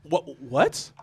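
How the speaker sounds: halting, mostly hushed acted shock; stunned disbelief. Clubgoer just called Uncle Tom, stunned disbelief. Acts out stunned reaction to insult.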